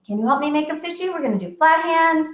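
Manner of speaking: plain reading voice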